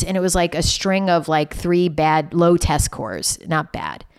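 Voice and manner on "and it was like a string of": ordinary delivery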